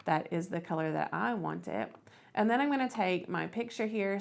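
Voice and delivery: ordinary talk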